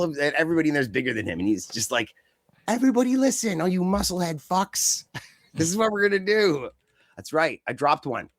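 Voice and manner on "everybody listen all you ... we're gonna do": hammed-up tough-guy leader taking over the ring; mock commander. Dolph Ziggler yelling orders at bigger wrestlers, mock bossy.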